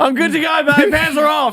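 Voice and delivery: high, loud, eager impression of her; eager mock wife. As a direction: play the wife keen to go, hammed up. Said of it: "She's like".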